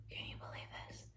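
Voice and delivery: breathy whisper of disbelief; whispered aside. Real, not acted. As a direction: lean in and whisper it. Aside about cruel Pharaoh.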